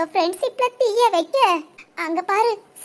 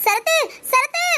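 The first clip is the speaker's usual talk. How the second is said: staged calling out; 7 semitones higher than usual. Acted. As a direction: shout his name for the teacher to hear. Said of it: "ஏண்டி கத்துற?". (கூச்சலிட்டு அழைப்பு)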